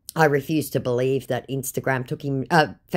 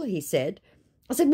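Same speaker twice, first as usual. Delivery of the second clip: ordinary delivery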